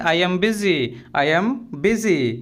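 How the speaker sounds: slow, carefully spelled-out English phrase; slow and clear classroom model phrase; slow teaching demo. Shown as a demonstration. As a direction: say each word slowly for the learner. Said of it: "तो हम बोलेंगे".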